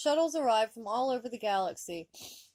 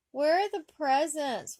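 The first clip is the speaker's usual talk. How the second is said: whined; 7 semitones higher, 6 dB louder than usual. A narrator's reading. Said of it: "Wesley whined".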